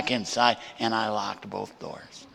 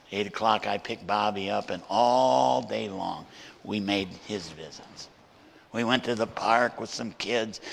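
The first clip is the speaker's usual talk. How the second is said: ordinary delivery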